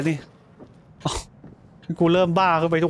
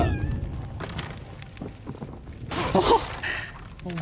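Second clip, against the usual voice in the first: long nervous laugh; 8 dB quieter than usual. Real, not acted. Laughs off the scare.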